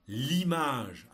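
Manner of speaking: slow, loud and distinct dictation; dictating. Shown as a demonstration. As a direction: read each word out for pupils to copy. Teacher dictating text to pupils.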